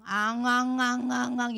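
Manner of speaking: repeated mock wails, running together; imitated crying. Teacher shows Sua's heart full of crying, repeated mock wails. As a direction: chain of pretend sobbing cries. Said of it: "우리 수아 마음과 생각 속에".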